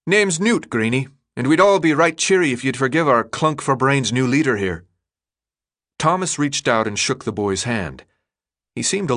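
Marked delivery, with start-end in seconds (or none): 0.1-4.8 put-on cheery British-style accent; thick odd accent. A narrator's reading. Friendly boy greeting the newcomer, thick odd accent. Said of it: "his voice thick with an odd accent".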